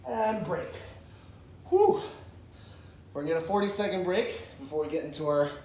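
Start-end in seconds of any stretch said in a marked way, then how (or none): 1.7-2.0 loud breathy exhale. Real, not acted. Exhale after the round.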